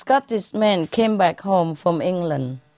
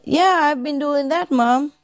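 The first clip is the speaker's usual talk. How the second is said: put-on high childish voice, pitched way up, with a laugh in it; little girl Lisa; 9 semitones higher than usual. Lisa answers her mother in a high, laughing kid voice. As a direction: play the clever kid, high and giggly. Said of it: "So Lisa said".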